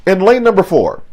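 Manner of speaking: announcer build-up, stretching the final name high and loud; lightly voiced track announcer, swelling at the end; stadium announcer. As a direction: hype the crowd like a meet announcer. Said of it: "that announcer's voice".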